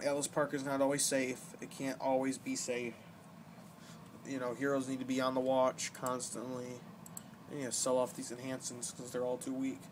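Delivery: ordinary talk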